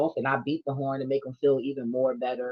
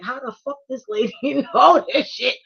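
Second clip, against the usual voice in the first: shocked disbelief; 9 semitones higher, 5 dB louder than usual. Retold or imitated. Girl's shocked reaction, acted quote.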